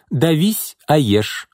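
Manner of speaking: high-pitched, no-nonsense command; curt order. A narrator's reading. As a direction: lay down the law, brooking no argument. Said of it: "отрезала Марина". (безапелляционно, резко)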